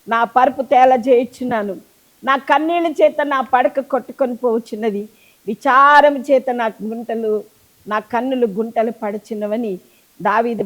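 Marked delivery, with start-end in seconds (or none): none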